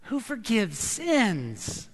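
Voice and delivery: very slow, puzzled guests' voice; murmuring dinner guests. Acted. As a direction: wonder aloud who this man is. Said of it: "They begin to say among themselves".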